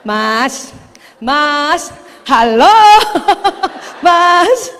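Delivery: sweet patient calling, a laugh breaking in; a wife calling her husband with a smile that never drops; smiling call. Shown as a demonstration. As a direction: keep smiling while calling him, laughing at the end. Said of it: "tolong pertahankan senyum sampai panggilan kelima".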